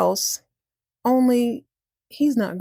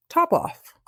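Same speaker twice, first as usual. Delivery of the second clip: higher, falling two-word answer; matter-of-fact announcement; 10 semitones higher than usual. Acted. Cat telling mouse the baby's name, high and sure. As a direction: toss out the odd name as settled fact.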